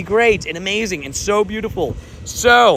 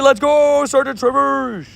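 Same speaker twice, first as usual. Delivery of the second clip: excited cheer; 7 semitones higher, slower than usual. Real, not acted. Cheering a viewer's shiny catch.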